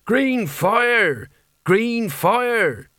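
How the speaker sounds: high-pitched cry of alarm; high, drawn-out battle cry; mild scream. A narrator's reading. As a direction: cry it out high, stretched, not full volume.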